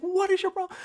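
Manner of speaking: very high-pitched imitation of a wife; mock indignant demand, voice shooting up; exasperated wife. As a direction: imitate a wife storming out annoyed. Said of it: "comes storming out of the house and she's like".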